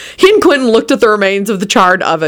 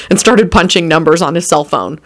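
plain reading voice

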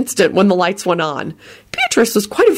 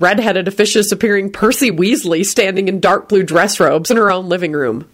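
ordinary delivery, nothing marked

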